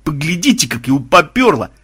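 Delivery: mild gleeful crowing. A narrator's reading. Tornado delighted at the raging vampire, gleeful. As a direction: crow with delight at his outburst. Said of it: "радостно запрыгал Торнадо".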